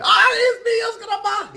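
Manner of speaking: very high, loud hammed-up cry; despairing Spanish wail. Spanish guy sees tacos and jumps, hammed-up despairing scream. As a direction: scream it like a doomed man in a joke. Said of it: "the Spanish guy opens up his lunch".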